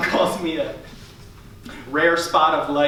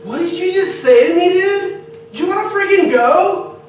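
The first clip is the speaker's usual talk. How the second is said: much higher, louder mock challenge; aggressive fan; 13 semitones higher, 6 dB louder than usual. Aggressive fan Raph confronts someone, high loud mock challenge. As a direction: pick a fight loudly. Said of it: "an aggressive fish fan".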